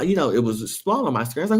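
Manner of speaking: usual speaking voice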